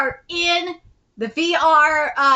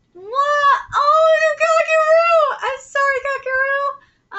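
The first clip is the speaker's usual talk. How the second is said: put-on high-pitched alarm over an upset child; flustered apology; 12 semitones higher, 5 dB louder, slower than usual. Apologizing to crying little Kakeru, high and flustered. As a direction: cry out high, then soften into an apology.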